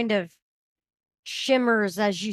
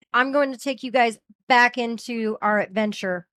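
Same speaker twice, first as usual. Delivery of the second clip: normal talking voice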